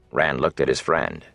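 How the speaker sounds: plain reading voice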